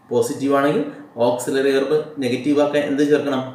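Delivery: ordinary talk, nothing marked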